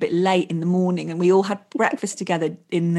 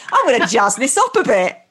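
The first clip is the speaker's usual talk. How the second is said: mock showman's announcement, pitched up and loud; showy wedding reader; 3 semitones higher, 6 dB louder than usual. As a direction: ham it up, building to a big hello. Imagined reader showing off at wedding.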